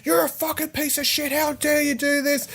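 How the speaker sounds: high shrieking parent; yelling parent voice. Retold or imitated. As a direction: mimic furious parents. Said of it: "in his face, like".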